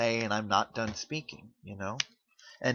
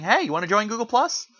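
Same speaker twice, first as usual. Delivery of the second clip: high, louder mock pop-up prompt; imitated sales pitch; 9 semitones higher, 5 dB louder than usual. Pop-up interrupting a user about to comment, mock sales pitch. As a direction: lightly play an eager promoter.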